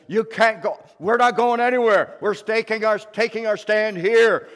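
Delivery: loud, high defiant wizard; Gandalf's defiant stand. Acted. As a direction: stand your ground and roar it out. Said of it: "and he says".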